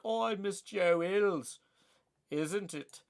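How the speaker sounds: slow, hammed-up bad British accent; a deliberately bad British accent, stretched out. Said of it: "That's my best bad British accent".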